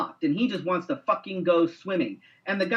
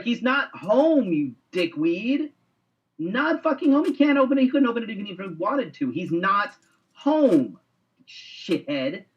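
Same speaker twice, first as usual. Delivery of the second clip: imitated exasperation; 4 semitones higher than usual. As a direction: act out a fed-up neighbor, stretching the key words. Acts out older neighbor's exasperated quarrel.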